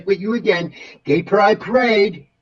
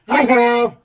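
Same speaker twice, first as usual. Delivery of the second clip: sing-song hi; 6 semitones higher, 3 dB louder than usual. Acted. Campy greeting to the computer.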